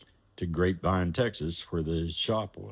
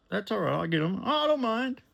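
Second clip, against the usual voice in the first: high, breezy rich man; high client voice; faster than usual. Retold or imitated. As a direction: pitch way up and wave it off. John voiced offering to help.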